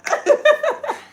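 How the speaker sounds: high-pitched burst of laughter; amused, high giggling laugh; real laugh. Real, not acted. Mom laughs at daughter's rap.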